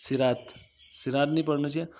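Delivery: normal talking voice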